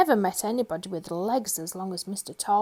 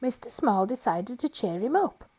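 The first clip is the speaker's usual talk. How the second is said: ordinary delivery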